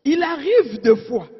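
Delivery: ordinary delivery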